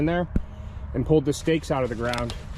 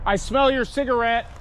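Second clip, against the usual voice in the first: calling out; 7 semitones higher than usual. Real, not acted. Warning the lurker.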